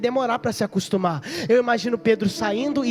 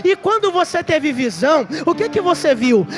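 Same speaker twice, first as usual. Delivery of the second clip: scoffing executioner; 7 semitones higher, 7 dB louder than usual. Acted. Executioner asks.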